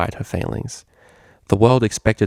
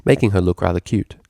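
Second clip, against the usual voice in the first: usual speaking voice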